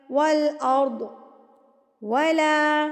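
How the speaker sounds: slow tajweed recitation, long vowels; Quran recitation. Shown as a demonstration. Teacher modelling the verse for students, slow measured recitation. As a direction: recite with measured, held syllables.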